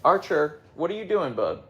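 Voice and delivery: cooing at the husky; pet talk. Real, not acted. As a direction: coo at the dog. Talking to dog in pet voice.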